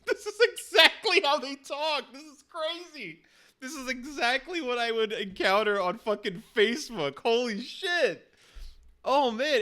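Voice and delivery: high, loud, laughing disbelief; amazed outburst. Real, not acted. Woman amazed the bot talks like Facebook creeps, excited. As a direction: burst out, thrilled at how accurate it is. Amazed at how real it sounds.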